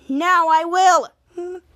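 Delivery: slow, loud retold threat; mad threat. Charlotte threatens to take Henry's job, mad. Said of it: "Charlotte was so mad".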